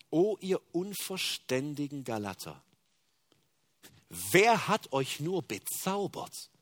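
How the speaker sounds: slow, measured scripture reading; slow solemn reading. Shown as a demonstration. Preacher first reads Paul's rebuke slowly and solemnly. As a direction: lay each word down slowly and gravely. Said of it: "er verurteilt es so scharf".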